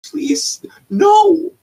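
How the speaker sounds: very high pleading, then a loud no; begging please no. Real, not acted. Watching a friend light things on fire, a panicked plea.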